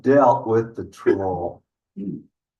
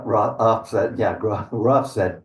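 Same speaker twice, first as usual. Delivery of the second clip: ordinary delivery, nothing marked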